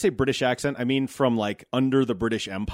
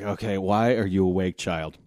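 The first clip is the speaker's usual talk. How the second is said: weary parent; 5 semitones lower than usual. Acted. Weary parent to early-waking kid.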